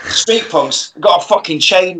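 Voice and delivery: ordinary delivery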